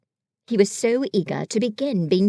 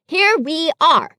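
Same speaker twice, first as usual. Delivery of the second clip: slow, deliberate, higher Rabbit voice; slow and careful; 7 semitones higher, 7 dB louder, slower than usual. Acted. Rabbit briefing friends slowly and carefully. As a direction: lay it out carefully, word by word. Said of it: "very slowly and carefully".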